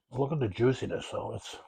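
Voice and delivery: talking through a mouthful of ribs; mouth full. Real, not acted. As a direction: speak around a bite of food. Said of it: "talking my mouth full".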